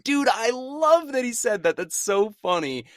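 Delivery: high, loud delight; thrilled fan raving loudly; excited gushing. Retold or imitated. Gushing over a favorite line.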